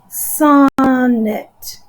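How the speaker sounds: "sonnet" with a long, stressed SON; SON-net, slowly split in two; stress on first syllable. Shown as a demonstration. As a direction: lean hard on the first syllable of "sonnet", then drop. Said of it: "accent over the beginning syllable".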